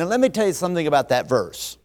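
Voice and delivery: normal talking voice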